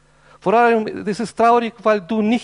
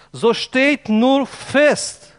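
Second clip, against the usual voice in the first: very slow and weighty "So steht nur fest"; emphatic verse reading; 3 semitones higher, much slower than usual. Real, not acted. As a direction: draw out each word of "So steht nur fest". Preacher repeats the key verse. („So steht nur fest“ sehr langsam und nachdrücklich)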